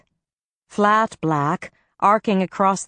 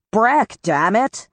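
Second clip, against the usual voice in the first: calling out; 4 semitones higher, slower than usual. Acted. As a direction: call after him, fed up and winded. Calling after someone walking away.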